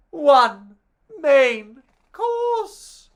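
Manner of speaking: loud gasp, then a dramatic announcement; gasping reveal. Acted. Narrator revealing the sausages as dinner, gasping. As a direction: gasp and land the punchline. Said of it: "gasp reveals they're eaten".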